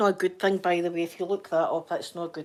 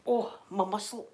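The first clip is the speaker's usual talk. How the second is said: ordinary talk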